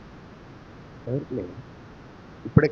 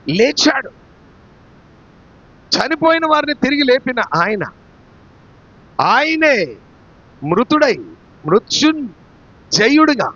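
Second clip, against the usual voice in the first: raised, emphatic preacher's voice; forceful preaching; 8 semitones higher, 6 dB louder than usual. Real, not acted. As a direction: proclaim it with rising force, stretching key words. (గట్టిగా ప్రకటించే ప్రసంగం)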